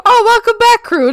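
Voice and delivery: high, loud laughing welcome; excited greeting. Real, not acted. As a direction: greet them bursting with delight. Greeting returning chatter.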